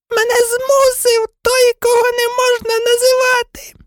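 very high squeaky pleading; frightened, whining Pettigrew. Acted. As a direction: make cowardly excuses in a high, stretched whine. Voices frightened Pettigrew making excuses. (тоненький переляканий благальний голос)

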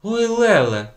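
high, loud shocked gasp of a word; shocked cry. A narrator's reading. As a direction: cry out in alarm. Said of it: "зойкнула вчителька". (переляканий зойк)

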